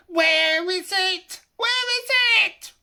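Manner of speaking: high, loud, slow wailing creature; crying out. A narrator's reading. Gollum searching his island for the ring, wailing. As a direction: wail in panic for the lost treasure. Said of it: "Bilbo heard him crying".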